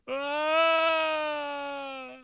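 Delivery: high, long wailing cry; sudden piercing howl of weeping; loud imitated sob. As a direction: wail at the top of your voice. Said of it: "Charlie sobbed at the top of his voice".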